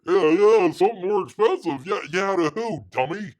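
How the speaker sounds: loud, high sarcastic yeah yeah; high-pitched sarcastic retort; mocking scoff. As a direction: scoff at the claim, then jab dummy. Mocking the producer's claim.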